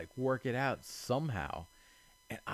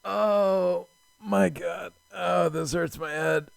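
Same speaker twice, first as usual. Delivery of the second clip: oh my God stretched out loud, then groaning higher; loud disbelief; 6 semitones higher, 8 dB louder, slower than usual. Real, not acted. Player groaning at the obvious answer, loud and disbelieving. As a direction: cry out at your own blindness. Realizes he missed it all along.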